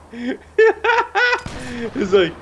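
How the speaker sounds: high laughing words. Real, not acted. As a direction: crack up mid-word. Laughing hard.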